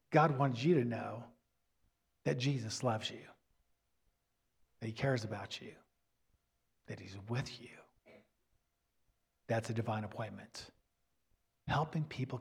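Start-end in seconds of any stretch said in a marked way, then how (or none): 0.1-7.7 sinking softer and lower to a near hush; hushed fading. Retold or imitated. Preacher voicing stranger's message to grieving mother, hushed and fading. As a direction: let it fade to almost nothing.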